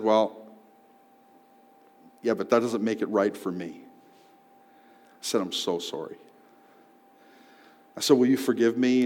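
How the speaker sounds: soft, quiet, earnest; sincere apology. Retold or imitated. Man apologizing to the clerk, quiet and sincere. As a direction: say it softly and humbly.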